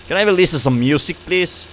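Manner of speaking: fast, high and loud plea; excited rush. Real, not acted. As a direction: blurt it out eagerly. Pleading with game radio.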